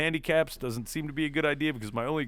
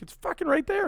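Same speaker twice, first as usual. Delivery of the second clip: much higher, squeaky exasperated blurt; exasperated exclamation. Retold or imitated. Pointing out the obvious to the one-eyed driver, high exasperated blurt. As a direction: blurt it out, pitched right up in disbelief. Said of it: "And I'm like".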